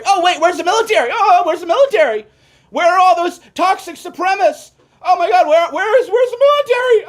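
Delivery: high frantic mock shouting, voice cracking; hammed-up hysterical calling out; mock panic. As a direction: shriek like a panicked fake searcher. Mocking frantic search for military.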